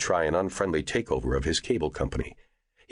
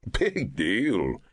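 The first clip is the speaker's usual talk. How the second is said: scoffing; 4 semitones higher than usual. A narrator's reading. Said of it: "Michael scoffed".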